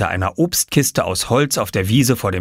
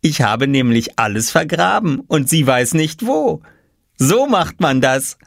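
put-on higher smug child voice; smug explaining; 6 semitones higher than usual. A narrator's reading. Oskar explaining his plan to Lars, smug. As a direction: gloat a little.

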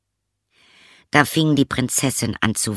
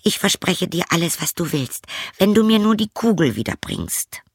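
higher, fast hasty promise; hasty princess; 9 semitones higher than usual. Acted. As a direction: rush the promise like a spoiled girl. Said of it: "sagte sie".

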